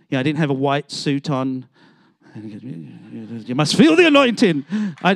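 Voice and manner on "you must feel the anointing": mock showy preacher. Mocking showy preacher style.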